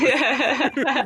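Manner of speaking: laughing. Real, not acted. Laughs mid sentence.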